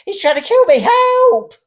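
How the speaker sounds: high, loud hysterical screams; screaming old woman. A narrator's reading. Old woman screams she is being killed, high and loud. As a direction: scream for the neighbours.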